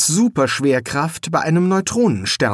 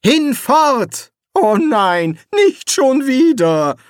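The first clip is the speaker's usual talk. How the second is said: high loud cry, slowing into dismay; dramatic banishing cry; 12 semitones higher, 5 dB louder, much slower than usual. A narrator's reading. Eric crying out at the demon, loud and high. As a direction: cast him out, then despair.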